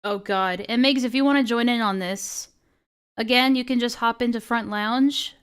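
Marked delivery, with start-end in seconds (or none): none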